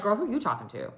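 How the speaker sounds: sassy clapback. Retold or imitated. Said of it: "And I would have been like".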